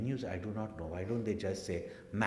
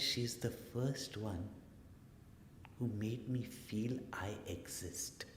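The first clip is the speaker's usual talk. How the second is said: slow, soft, acted sincerity; hushed, unhurried heartfelt child; child's heartfelt confession; 8 dB quieter, slower than usual. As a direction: say it slowly and quietly, letting it land. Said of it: "what that child said that day".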